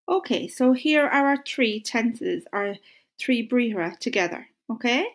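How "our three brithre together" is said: normal talking voice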